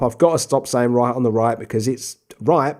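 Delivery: normal talking voice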